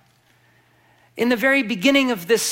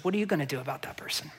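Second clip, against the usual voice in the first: hushed, unvoiced question turned back on the listener; whisper; 5 semitones lower, 11 dB quieter, much faster than usual. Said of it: "God looks to us and says".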